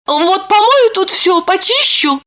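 high-pitched heroine thinking aloud; high, determined little voice; Lipochka, planning. A narrator's reading. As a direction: make plans in a thin, high voice.

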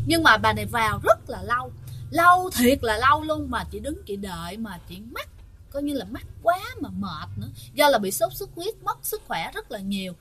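ordinary delivery

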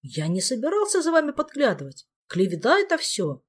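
hurt, indignant protest; offended. A narrator's reading. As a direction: protest like you're wounded. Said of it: "обиделся дух". (обиженно)

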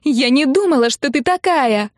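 high, loud accusation; angry wailing. A narrator's reading. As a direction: cry it out as if betrayed. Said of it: "ещё громче завопила сестра".

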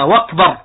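"وكبر" pronounced wakbar, opening on W; hamza turned to waw. Shown as a demonstration. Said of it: "يبدل الهمزة واوا".

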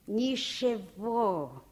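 Ничего drawn out very slowly, each syllable lingered on; savoring the word. Acted. [name] savors her favorite Russian word Ничего slowly. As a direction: stretch Ничего lovingly and slow. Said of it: "люблю одно русское слово".

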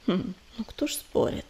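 ordinary delivery, nothing marked

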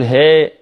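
ها said as "he"; slack kasra on ha. Shown as a demonstration. Teacher imitating a mistake, "he" for "hi" in ها. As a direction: open the i to an e in ها. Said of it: "الهاء في كلمة الله".

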